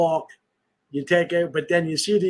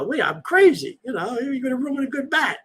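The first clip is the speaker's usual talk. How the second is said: raised, half-shouted street-tough impression; lightly hammed Philly hoodlum, higher; Philly tough guy; 9 semitones higher than usual. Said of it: "you know, fighting".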